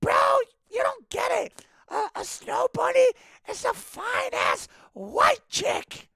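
very high falsetto impersonation; Lewis. Retold or imitated. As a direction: imitate a coworker with a really high-pitched voice. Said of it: "a really high-pitched voice".

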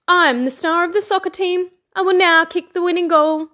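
high, proud announcement; boastful. A narrator's reading. Fox playing soccer star in the meadow, boastful. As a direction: brag with the pitch held up.

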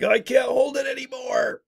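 high strained whimper, bursting to go; high pinched desperate plea; desperate whine. Retold or imitated. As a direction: squeak it out like you can't hold it. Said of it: "You're like".

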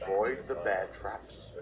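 ordinary talk

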